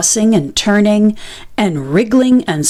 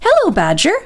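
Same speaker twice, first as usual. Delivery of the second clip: high, bright hello; cheery; 7 semitones higher, faster than usual. A narrator's reading. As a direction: greet him gladly. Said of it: "he said cheerily".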